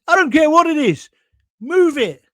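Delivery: high, loud, impatient order; exasperated shout. Retold or imitated. Customer ordering the landlord to shift his dog, exasperated shout. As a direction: cut the owner off, loudly.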